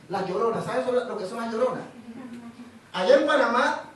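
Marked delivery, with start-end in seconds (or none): none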